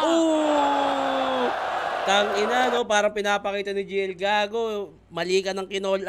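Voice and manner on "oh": loud, high, drawn-out whoop; long hyped "ohh". Real, not acted. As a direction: hold a big crowd-style "ohh". Cheering a line in the battle. (mahabang hiyaw ng paghanga)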